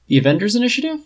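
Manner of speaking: high rising disbelief; surprised at the offer; incredulous. A narrator's reading. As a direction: ask it as if stunned. Tony incredulous question.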